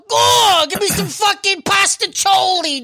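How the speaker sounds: high, loud hammed-up Italian accent; over-the-top Italian mobster accent, demanding pasta; mock Italian mobster. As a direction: shout it like a cartoon mobster. Repeats mobster food bit.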